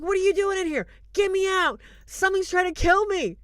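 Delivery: desperate plea. Retold or imitated. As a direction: beg to be let out like a trapped man. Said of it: "He's getting desperate".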